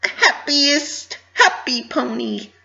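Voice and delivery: high and slow, drawn-out sing-song; forced cheer. Acted. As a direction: stretch each word with hollow brightness. Pinky forcing cheerfulness.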